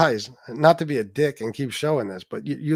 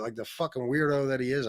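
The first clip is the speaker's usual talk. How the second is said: ordinary talk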